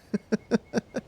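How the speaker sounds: a quick, high little laugh; light laugh. Real, not acted. Streamer laughing about struggling with English, light laugh.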